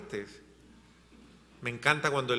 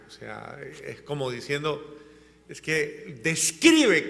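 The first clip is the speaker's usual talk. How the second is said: usual speaking voice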